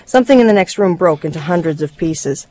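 plain reading voice

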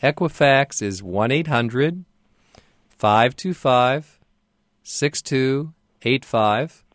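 reading out digits slowly and clearly; slow dictation. Real, not acted. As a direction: spell out each number slowly for the caller. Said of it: "Would you please give me the phone numbers".

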